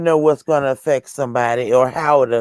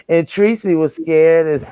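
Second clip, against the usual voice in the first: talking through real laughter; amused, laughter breaking in; laughing; 2 semitones lower than usual. Real, not acted. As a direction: laugh at both ends of the line. Laughing about Tracy being scared.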